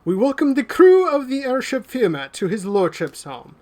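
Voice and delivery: high, grand announcement of the guests; formal butler announcing the crew. Acted. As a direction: proclaim it down from the banister. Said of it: "turns around and says".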